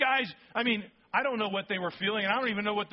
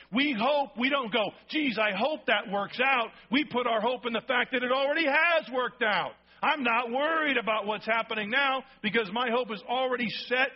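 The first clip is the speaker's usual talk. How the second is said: rising, louder preaching push; preaching at full force; 4 semitones higher, 3 dB louder than usual. Real, not acted. Preacher pressing hope on the congregation, louder and higher.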